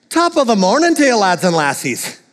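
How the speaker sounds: laughing mock Irish hello; Irish greeting again. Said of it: "let's try that again".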